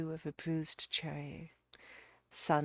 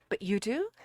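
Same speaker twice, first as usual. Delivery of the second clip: higher, louder hopeful question; eager; 6 semitones higher, 7 dB louder than usual. A narrator's reading. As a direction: ask eagerly, lifting at the end. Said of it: "I said eagerly".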